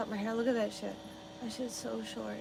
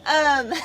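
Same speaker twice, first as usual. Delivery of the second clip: laughs, stops with "um", then laughs again, louder; real giggling fit; laughing at own joke; 6 semitones higher, 8 dB louder than usual. Real, not acted. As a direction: crack yourself up with a high giggle.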